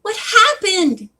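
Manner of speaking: high, drawn-out astonishment; gasped surprise. A narrator's reading. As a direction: ask in startled disbelief. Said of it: "Bess gasped".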